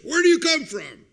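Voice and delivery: loud, high-pitched interrogating shout; sudden harsh outburst; harsh questioning. Acted. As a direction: demand it harshly, like a stern official. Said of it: "he spoke harshly with his brothers".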